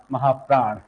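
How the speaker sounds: normal talking voice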